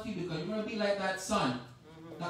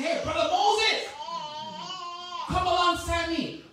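friendly call; 6 semitones higher than usual. Acted. Calling out to Moses.

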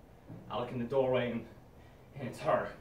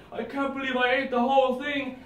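TV ad voice; 8 semitones higher, 3 dB louder than usual. Acted. TV ad catchphrase.

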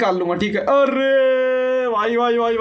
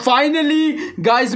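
usual speaking voice

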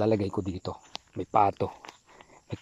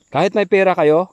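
louder, higher insistent warning; emphatic; 6 semitones higher, 10 dB louder than usual. Real, not acted. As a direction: push the words out to warn them. (mariing babala, mas malakas)

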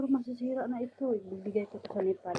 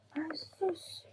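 whispered ay sus; 7 semitones higher, 5 dB quieter than usual. Real, not acted. Reacting to finding pretty bags.

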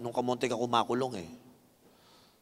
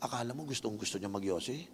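normal talking voice

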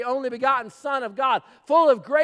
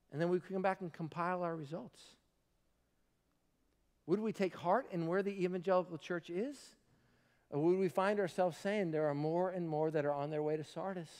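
softly musing; 8 semitones lower, 13 dB quieter than usual. Real, not acted. Quiet musing survey section.

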